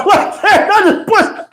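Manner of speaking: can't get it out for laughing. Real, not acted. Laughing while retelling.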